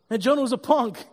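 ordinary talk, nothing marked

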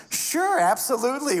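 high, swooping eager agreement; sudden high-pitched, gracious yes; mock generous. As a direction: agree with exaggerated willingness. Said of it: "I've started to feel very generous".

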